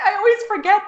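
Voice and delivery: high mock-apologetic voice; imitating a sheepish friend. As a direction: play a Czech friend realising the slip. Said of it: "they'd be like".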